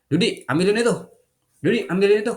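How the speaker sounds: loud, high, rude command; loud demanding order shouted at a tired worker; bossy order. Shown as a demonstration. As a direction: bark it like a bossy employer. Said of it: "jangan gini".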